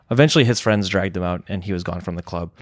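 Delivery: ordinary talk, nothing marked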